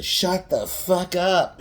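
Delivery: slow, high and loud snap, drawn out word by word; a hammed-up teacher losing it; mock yell. Teacher silences the nerdy girl.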